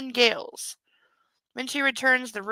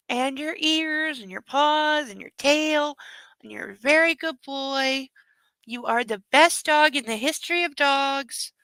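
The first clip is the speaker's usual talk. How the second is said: doting on dog; 3 semitones higher, 5 dB louder than usual. Real, not acted. Doting on the puppy before its nap. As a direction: praise the dog, slow and adoring.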